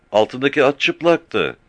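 put-on young boy answering fast; shepherd boy. Frightened shepherd boy answering the captain, high child voice.

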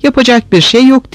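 lightly lisped, high-pitched voice; lisping. A narrator's reading. Oksana tells the girls nothing can be done, lisping. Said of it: "peltek peltek konuşarak".

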